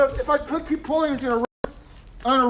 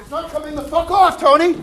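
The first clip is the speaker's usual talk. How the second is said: loud, frustrated, raised voice; angry complaint; 3 semitones higher, 9 dB louder than usual. Acted. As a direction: yell it out in frustration. Mask won't come off his face.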